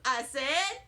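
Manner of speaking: plain reading voice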